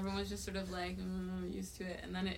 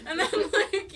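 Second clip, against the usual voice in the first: laughing; 5 semitones higher, 6 dB louder than usual. Real, not acted. Laughing at tour story.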